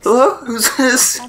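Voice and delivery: very high, slow phone hello; squeaky friend impression. Imitating Tanner picking up the phone, silly high voice. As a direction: answer the phone in falsetto. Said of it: "Why do I sound like this?".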